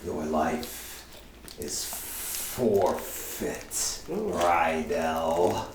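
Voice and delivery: low, very slow whispered verdict; soft creature voice with long pauses; menacing witch. Acted. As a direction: pronounce doom in a drawn-out hush. GM passes sentence as the witch.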